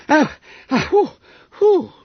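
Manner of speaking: high, loud breathless gasping whews; winded gasps. Acted. Boy winded by a collision, gasping high. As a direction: gasp for air after the crash. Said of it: "gasped Bob".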